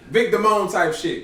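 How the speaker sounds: loud, slow, emphatic call-out; loud exclamation. Real, not acted. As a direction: belt out the name reference.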